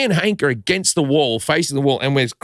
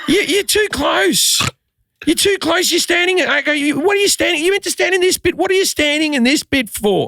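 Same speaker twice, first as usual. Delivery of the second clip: very high, strained yelling rant; losing his cool; 14 semitones higher than usual. Retold or imitated. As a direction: shriek it at people crowding you. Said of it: "I've lost my, my, my cool".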